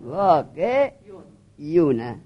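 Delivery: واقع یونه in two parts, vā-qe, then yu-na; واقع یونه broken after vā-qe, rising then falling; original uncontracted form. Shown as a demonstration. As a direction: sound out the y in واقع یونه as its own syllable. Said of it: "واقعونه اصلش چه بود؟".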